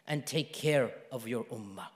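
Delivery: very slow, soft, low comfort; hushed promise fading almost away; tender reassurance. Retold or imitated. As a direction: soothe gently, with long pauses. Retelling God's comforting message.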